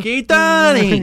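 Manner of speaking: loud, high, drawn-out holler; calling out. Acted. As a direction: yell to wake a sleeper. Waking the sleeping reindeer.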